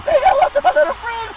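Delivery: high, loud mock quote. Host mixing the Scarface line into his [name] bit, high. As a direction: bark the line in the high impression voice. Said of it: "movie with Scarface".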